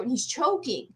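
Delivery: ordinary talk, nothing marked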